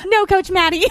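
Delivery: high, mock-protesting pupil; student voice. Shown as a demonstration. Coach demonstrates her students to co-host, high student voice. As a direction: imitate a student refusing, pitched high. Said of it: "that's a voice I always use for my students".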